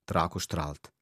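put-on lively read-aloud children's story voice; story narration. A narrator's reading. Author reading his book aloud to children, lively narration. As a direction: tell it brightly to young listeners, voicing each kid.

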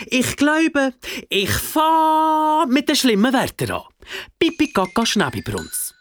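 put-on high-pitched, teasing child voice; gleefully threatening kid; taunting child. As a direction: taunt the monster like a naughty child. Child gleefully threatens monster with naughty words.

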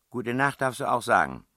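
high, loud teasing command; bossy princess. A narrator's reading. Princess sending the man off to bed, high bossy tease. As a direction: grant permission loudly, pitched well up.

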